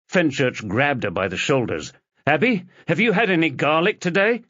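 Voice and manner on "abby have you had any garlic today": fast, high urgent question. Acted. Husband suddenly alarmed, questioning his wife fast and urgently. Realises danger, grabs her shoulders.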